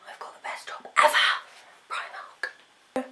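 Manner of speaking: quick whisper aside. Real, not acted. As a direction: drop to a whisper for the joke. Whispered aside about her top.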